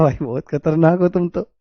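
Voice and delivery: quiet laughing teasing; softly laughing at the students. Retold or imitated. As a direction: tease them through a laugh.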